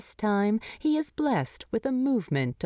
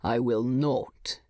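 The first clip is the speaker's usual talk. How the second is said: low, curt refusal from Thorin; near-snap; 6 semitones lower than usual. Acted. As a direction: clip the refusal, just short of snapping. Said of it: "It's not a snap, but it's not far off".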